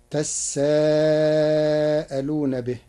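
تساءلون with geminated seen and long madd; doubled seen in تساءلون. Shown as a demonstration. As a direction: lean on the doubled s, then hold the aa of تساءلون. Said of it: "بتشديد السين".

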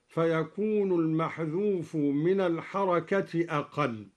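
normal talking voice

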